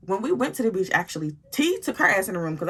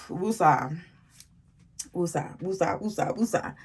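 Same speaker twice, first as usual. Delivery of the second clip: calming breath; 3 semitones lower, 5 dB quieter than usual. Real, not acted. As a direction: breathe out and settle yourself down. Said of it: "I'm getting upset just thinking about it".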